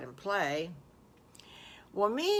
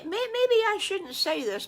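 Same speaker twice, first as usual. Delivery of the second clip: bashful blurt; 12 semitones higher, 6 dB louder than usual. Acted. Wolf hesitantly confessing, pitch jumps.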